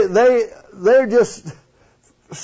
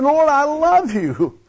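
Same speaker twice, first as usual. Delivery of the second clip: a lightly voiced Peter swearing devotion; voicing Peter; 5 semitones higher than usual. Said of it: "He said".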